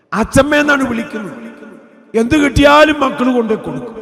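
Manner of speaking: preacher praising his late wife, loud and fervent; impassioned preaching. Acted. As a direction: lift the congregation with it. Impassioned sermon peak.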